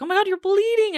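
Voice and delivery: high-pitched mock alarm. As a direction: imitate someone startled by an injury. Acts the guy alarmed at blood.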